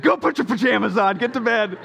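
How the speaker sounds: exasperated dad order. Retold or imitated.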